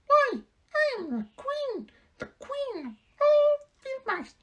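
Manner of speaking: very high, loud, slow proud squeak; mouse queen voice. Acted. Queen telling the Woodman who she is, proud squeak. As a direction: announce her rank grandly in a squeak.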